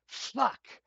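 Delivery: loud, high yelp of a swear; startled curse. Acted. Researcher startled by the officer behind, loud curse. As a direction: blurt it out as if jumped from behind.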